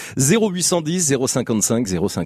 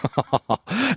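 amused laugh; 6 semitones higher than usual. Real, not acted. Host amused by the teacher's bar nights. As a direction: chuckle at the nightlife story.

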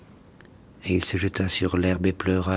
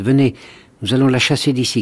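calling out; 5 semitones higher, 4 dB louder than usual. Acted. Star child urges cruel chase.

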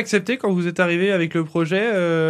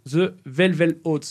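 slow, self-mocking English accent on the name; slower than usual. Shown as a demonstration. Radio host to guests, jokey slow English accent. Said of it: "avec mon super accent anglais".

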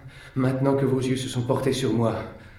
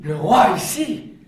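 astonished; 10 semitones higher, 6 dB louder, slower than usual. Acted. Prisoner astonished at king.

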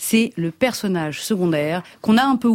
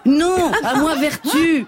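laughing exclamation; 7 semitones higher, slower than usual. Acted. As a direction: cry out for virtue, cracking up. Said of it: "je joue la princesse de Clèves". (s'écrier en riant)

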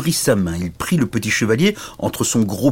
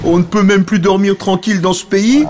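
loud, high-pitched grouchy dragon; hammed-up indignant dragon complaint; angry dragon; 11 semitones higher, 9 dB louder than usual. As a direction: rant at being woken from a nap. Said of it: "ajouta le dragon, et ses flammes".

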